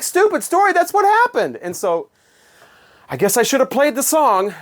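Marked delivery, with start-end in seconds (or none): 0.0-1.6 indignant disbelief. Retold or imitated. Retorting to the boss's accusation.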